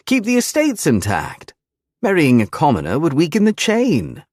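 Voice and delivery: put-on high, gloating young man voice; smug bully voice. A narrator's reading. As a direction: lay it out smugly, rubbing it in.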